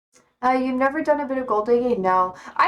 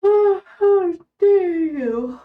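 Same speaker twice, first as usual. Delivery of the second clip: very slow, high, louder fake-crying how dare you; mock outrage; 8 semitones higher, 5 dB louder, much slower than usual. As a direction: ham up the wounded cry. Theatrical outrage at chat question.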